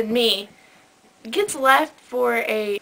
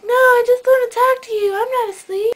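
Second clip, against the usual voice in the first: high, louder imitation of a pleading caller; mock protest; 12 semitones higher, 6 dB louder than usual. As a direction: protest like a sleepy friend caught dozing. Imitating sleepy caller denying sleep.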